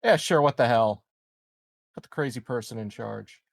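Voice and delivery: ordinary talk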